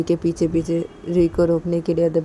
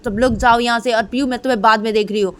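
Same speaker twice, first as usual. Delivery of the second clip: loud, higher shout, fast at the end; 5 semitones higher, 5 dB louder than usual. Acted. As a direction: shout everyone away. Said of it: "चिल्ला के बोलती". (चिल्लाकर बोलना)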